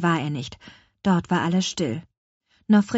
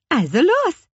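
lively call; 11 semitones higher, 7 dB louder than usual. A narrator's reading. Witch starts the flight.

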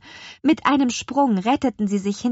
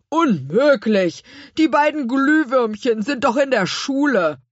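loud, higher put-on voice; emphatic, dismissive retort; dismissive character voice; 5 semitones higher, 5 dB louder than usual. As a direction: scoff as a comic storybook figure. Gurkenhut dismissively answers the witch.

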